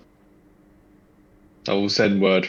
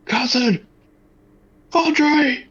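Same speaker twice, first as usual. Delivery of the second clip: high desperate cry; anguished shout; 5 dB louder, much slower than usual. Acted. Calling to dying cousin.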